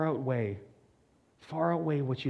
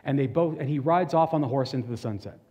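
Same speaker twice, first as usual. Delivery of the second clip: usual speaking voice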